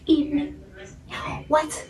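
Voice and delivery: high, hushed, breathy what; stunned disbelief. Retold or imitated. Told he won't drive them, she asks in hushed shock. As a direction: barely get the word out, stunned.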